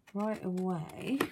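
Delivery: ordinary delivery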